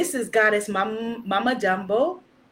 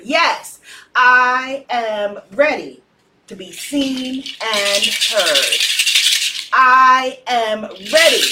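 loud, slow, rousing affirmation, repeated; declaring the affirmation; 5 dB louder, slower than usual. Real, not acted. Leader rallying the circle, loud and slow. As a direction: proclaim it with full force. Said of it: "Type that in the comments".

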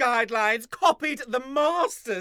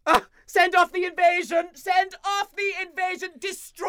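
higher, frantic war cry; ranting command; 8 semitones higher than usual. Acted. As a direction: bellow the order. Raymond ordering the invasion.

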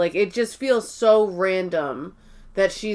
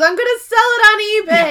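high, fast and louder; imitating Meredith; 12 semitones higher, 7 dB louder, faster than usual. Cohost retelling Meredith's eBay line, high and fast. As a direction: rattle it off brightly. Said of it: "She goes".

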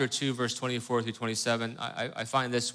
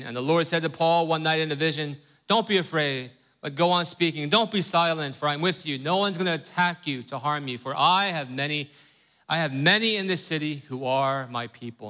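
scripture reading; 4 semitones higher than usual. Acted. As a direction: lift the Bible text out of the teaching talk. Reads scripture aloud.

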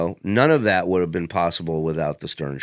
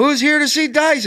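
high, loud emcee question; onstage crowd work; 5 dB louder than usual. Retold or imitated. Comic redoing his opener to a Toronto crowd. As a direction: call it out to the theater.

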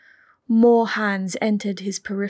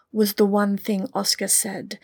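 ordinary delivery

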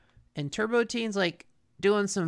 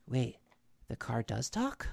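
lower, much softer mock puzzled aside; retold puzzlement; 9 semitones lower, 10 dB quieter than usual. Retelling the villain noticing the talking car, quietly puzzled. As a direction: quietly puzzle over it, low and soft. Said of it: "and he's like".

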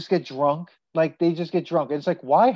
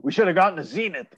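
quick, put-on grumble from a sour old neighbor; grumpy neighbor; 3 semitones higher, faster than usual. Friend imitating the TV-obsessed neighbor for a co-host, quick grumbling. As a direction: mimic the neighbor's fast grousing about his TV. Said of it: "I've never heard anyone go".

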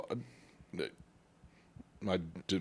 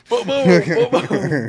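stomping noises; 9 semitones higher, 9 dB louder, much slower than usual. Retold or imitated. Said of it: "she's like".

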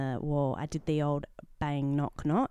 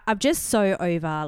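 ordinary talk

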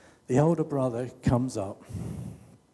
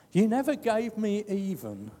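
whingy; 8 semitones higher than usual. Retold or imitated. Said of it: "We can be whingy".

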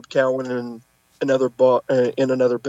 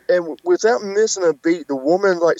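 ordinary delivery

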